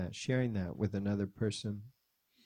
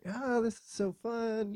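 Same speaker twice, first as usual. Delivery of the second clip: put-on high, singsong toddler voice; gleeful little kid; 11 semitones higher than usual. Son riding on dad's shoulders, imitated childish glee. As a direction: imitate a delighted small child.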